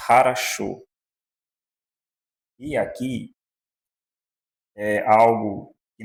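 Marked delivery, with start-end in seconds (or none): 0.1-0.8 harasho with the first O said as A, stress on the last syllable; Russian harasho. Shown as a demonstration. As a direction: say harasho, not horosho. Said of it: "essa palavra aqui nós lemos".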